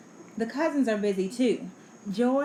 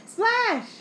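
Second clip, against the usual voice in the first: high, loud burst; excited exclamation; 12 semitones higher, 6 dB louder than usual. A narrator's reading. Waves splash in story.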